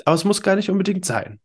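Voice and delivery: normal talking voice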